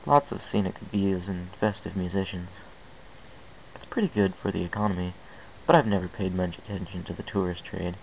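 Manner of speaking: plain reading voice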